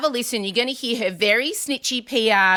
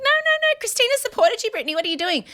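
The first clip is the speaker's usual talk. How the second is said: much higher pleading no no no; exasperated inner voice; faster than usual. Retold or imitated. Host recalls her thoughts, high and exasperated. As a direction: squeal it at Britney in disbelief. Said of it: "I just remember thinking".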